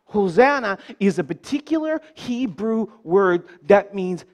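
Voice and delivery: ordinary talk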